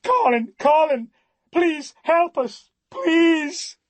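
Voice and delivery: high, loud, frantic scream for help; screaming in terror. Acted. Woman screaming for help as her man collapses, frantic high scream. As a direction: shriek his name as if he's dying. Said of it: "a woman is screaming".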